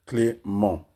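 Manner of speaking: two clearly parted syllables. Shown as a demonstration. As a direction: split the name into two distinct beats. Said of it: "deux syllabes".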